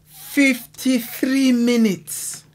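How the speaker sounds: high, slow, drawn-out disbelief; stunned. Real, not acted. Amazed reactor repeating the raid's length, slow and high. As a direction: stretch it out, amazed.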